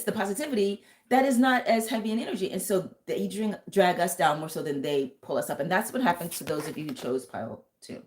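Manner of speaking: normal talking voice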